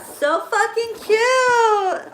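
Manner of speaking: high, stretched-out cooing delight; gushing squeal. Real, not acted. Gushing over a cartoon made for her, excited squeal.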